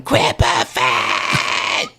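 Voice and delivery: very slow drawn-out mock chant; lightly voiced Cartman chant; imitating Cartman. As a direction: drag it out like a cartoon kid. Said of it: "running around the entire town going".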